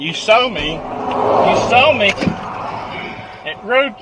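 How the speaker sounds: loud pleading. Real, not acted. Pleading loudly with officer.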